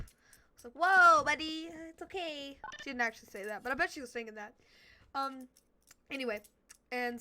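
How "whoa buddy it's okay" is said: loud whoa then put-on soothing buddy; mock calming. Speaker pretending to calm the furious teacher, mock loud. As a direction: talk the teacher down, hammed-up.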